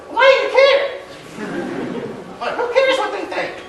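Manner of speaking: high-pitched mock Boston accent. Teller mimicking his Boston friend's blunt question, in a high Boston accent. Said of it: "he's from Boston, so he would say it like this".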